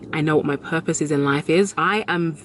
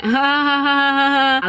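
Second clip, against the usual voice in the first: mock fake laugh; 9 semitones higher, 3 dB louder, faster than usual. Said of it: "I was like".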